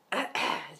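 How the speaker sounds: quick rough throat clear. Real, not acted. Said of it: "See, hear that voice crack?".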